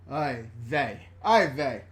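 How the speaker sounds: laughing through the words; can barely get it out for laughing. Real, not acted. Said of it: "You gave me hiccups".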